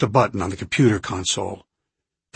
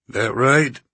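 drawl; 3 semitones higher than usual. A narrator's reading. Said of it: "still drawling".

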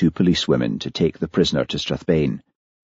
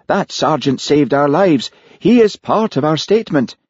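slightly higher, emphatic objection; waspish; 10 semitones higher, 4 dB louder than usual. A narrator's reading. Minister insists the sergeant stay, waspish and raised in pitch. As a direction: insist testily, pitch climbing. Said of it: "said Peter waspishly".

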